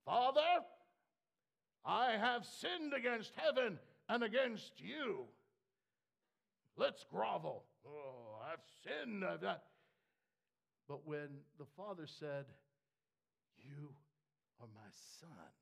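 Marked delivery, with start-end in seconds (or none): 0.0-9.6 loud pleading son begging forgiveness; groveling son. Acted. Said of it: "he came home very boldly". 13.6-15.5 softer, lower father; a hint of a tender father. Father answers the prodigal son.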